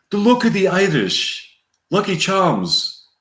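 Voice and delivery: high, lilting stage-Irish accent; drawn-out, exaggerated Irish lilt. Shown as a demonstration. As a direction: try out a cartoon Irish accent. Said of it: "How do I do an Irish accent?".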